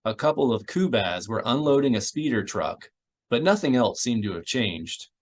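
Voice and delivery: usual speaking voice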